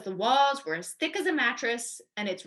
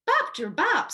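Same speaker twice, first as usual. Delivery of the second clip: bouncing sound; 8 semitones higher, 5 dB louder than usual. A narrator's reading. Grandma bounced in gourd.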